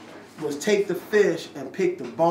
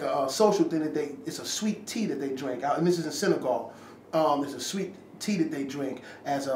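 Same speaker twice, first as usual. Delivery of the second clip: ordinary talk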